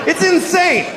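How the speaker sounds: high, exasperated cry; exasperated outburst. Acted. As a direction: shoot the pitch right up in disbelief. Exasperated rant about the film.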